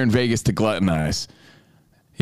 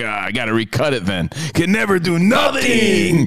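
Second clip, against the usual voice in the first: mock frustrated whine; 6 semitones higher than usual. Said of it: "I'm like".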